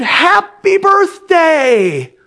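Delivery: long drawn-out loud cheer; screamed greeting. Acted. Crowd shouting surprise greeting to Agnes. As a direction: yell it out as a surprise. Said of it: "we screamed".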